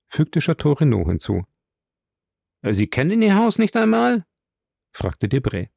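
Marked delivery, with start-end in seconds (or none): 2.6-4.3 astonished question. A narrator's reading.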